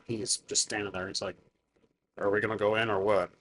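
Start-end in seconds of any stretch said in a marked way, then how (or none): 2.2-3.3 low, quick big-guy voice; low-voiced brute wanting to get going. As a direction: play a big guy, pitched down and softer. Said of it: "just a big guy like this".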